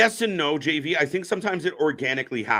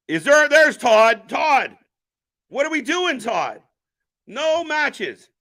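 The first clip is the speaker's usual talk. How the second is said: loud, much higher, excited hail; excited calling out; 11 semitones higher, 5 dB louder, slower than usual. Real, not acted. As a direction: greet a buddy you just spotted. Spots Todd and hails him.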